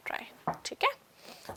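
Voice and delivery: फुसफुसाकर बोलो; बस साँस में धीरे से कहो; whisper. Real, not acted. Teacher ends homework instruction softly. (Homework देकर फुसफुसाहट में "ठीक है")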